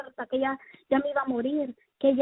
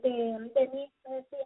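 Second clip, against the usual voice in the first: ordinary talk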